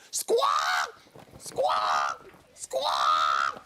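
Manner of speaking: low, rough bird squawks; parrot squawk. Acted. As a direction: squawk like a big green bird. Bird flaps around the studio.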